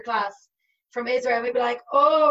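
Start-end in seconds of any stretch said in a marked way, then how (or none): 1.9-2.3 loud, playful mock "oh"; teacher's ribbing, louder and higher; teasing. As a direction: tease the kids with a raised, louder exclamation. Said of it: "mess with the girls".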